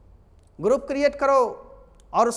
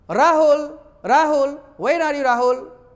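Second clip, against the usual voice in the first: Rahul shouted higher and louder, r hit hard; loud calling with strong r; 5 semitones higher, 8 dB louder, slower than usual. Shown as a demonstration. Teacher demonstrating a loud call with a strong r. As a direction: call out Rahul across the room, slow where. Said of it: "Rahul को रॉ को जोर मार करना है".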